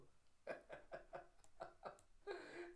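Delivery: high, hushed run of giggles; self-satisfied giggle. Retold or imitated. Thinking to herself no one will know, smug secret giggle. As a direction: titter secretly to yourself. Secretly proud character chuckles.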